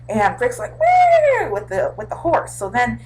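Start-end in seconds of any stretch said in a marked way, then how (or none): 0.8-1.5 startled whoop. Retold or imitated. Rick startled on horse.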